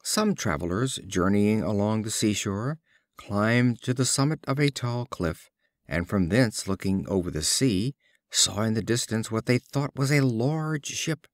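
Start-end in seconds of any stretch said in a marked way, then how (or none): none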